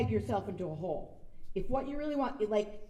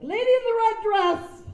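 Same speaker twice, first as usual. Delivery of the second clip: loud, high shout across a set; a director yelling across the set at an extra; mock director yell; 12 semitones higher, 10 dB louder than usual. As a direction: bark it out like a director calling an extra.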